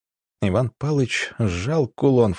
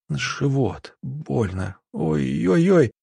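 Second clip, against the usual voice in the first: pained, trembling voice; 4 semitones higher, much slower than usual. A narrator's reading. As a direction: groan it out as if doubled up with bellyache. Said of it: "Голос дрожал, как струна". (слабый стонущий голос больного)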